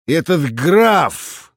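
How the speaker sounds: slow, high scoffing exclamation; scornful snort. A narrator's reading. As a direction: snort it out with contempt. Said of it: "директор фыркнул". (презрительно фыркая)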